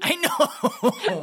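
very high, laughing exclamation; laughing agreement. Real, not acted. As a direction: squeal it through a real laugh. Amused, exaggerated agreement with laugh.

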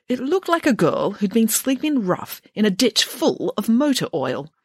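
usual speaking voice

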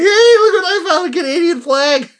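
high, loud excited exclamation; thrilled at a find, voice leaping up; excited squeal. Retold or imitated. Showing off the old flag he found, loud and high.

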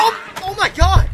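high, loud cry of shock; shocked shout. Acted. Announcer watching the interviewer get attacked, shocked shout. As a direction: yell it out in alarm.

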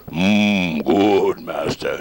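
slow monster voice, lightly done; measured, halting monster servant; Frankenstein. Shown as a demonstration. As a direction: grunt it word by word like a movie monster. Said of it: "instead of having a voice".

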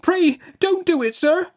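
put-on very high, terrified little boy; pleading in terror. A narrator's reading. Pip begging the man who seized him, pleading in terror. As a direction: beg for your life in a high child's voice. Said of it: "I pleaded in terror".

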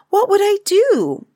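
put-on very high, loud child's question; high-pitched demanding question; challenging question. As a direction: pitch it right up, putting Daddy on the spot. Taffy challenges her father with a question.

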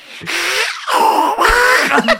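high, squawking duck gibberish; imitated cartoon duck noise; Donald. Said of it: "Donald constantly being like".

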